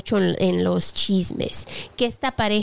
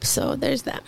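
whispered aside; 3 semitones higher, 4 dB quieter, slower than usual. Real, not acted. Muttered aside.

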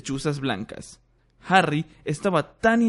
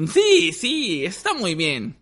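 high, cheerful little professor voice; beaming squeaky professor; 5 semitones higher, slower than usual. Acted. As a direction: beam at him in a squeak. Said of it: "mirando radiante a Harry".

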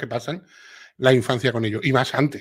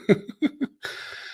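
wheezy silent laugh; 12 semitones higher, 5 dB quieter than usual. Real, not acted. Laughs at own accent joke.

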